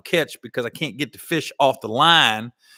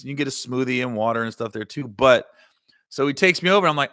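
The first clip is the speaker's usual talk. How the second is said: ordinary talk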